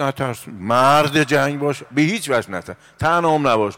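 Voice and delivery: rally the crowd not to fear; rousing exhortation. Acted.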